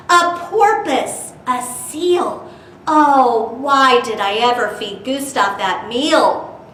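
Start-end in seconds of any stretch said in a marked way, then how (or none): none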